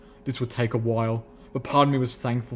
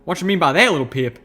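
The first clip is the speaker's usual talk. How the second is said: loud, higher folksy challenge; Calamity's sharp question; 5 semitones higher, 6 dB louder than usual. A narrator's reading. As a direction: call out the mumbler, rustic and pointed.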